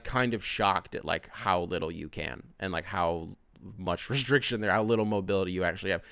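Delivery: ordinary talk, nothing marked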